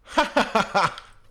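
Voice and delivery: loud, high burst of laughter; big gleeful laugh; delighted cackle. Real, not acted. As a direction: crack up loudly in delight. Delighted at finding diner booths.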